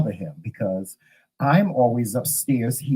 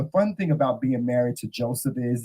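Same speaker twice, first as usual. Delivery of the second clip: ordinary delivery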